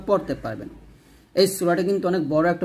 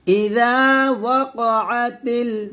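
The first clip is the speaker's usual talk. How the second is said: drawn-out melodic recital, higher; slow recitation demo; 4 semitones higher, much slower than usual. Shown as a demonstration. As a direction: recite each word slowly for students. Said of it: "এখানে দেখুন". (ধীরে সুর করে তিলাওয়াত)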